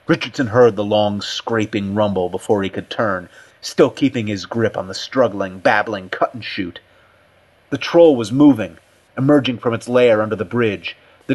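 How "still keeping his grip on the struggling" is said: usual speaking voice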